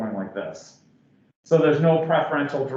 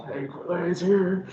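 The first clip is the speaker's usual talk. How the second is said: Kool-Aid Man; 5 semitones higher, slower than usual. Retold or imitated. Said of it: "the guy busting through the wall".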